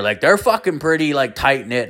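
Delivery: usual speaking voice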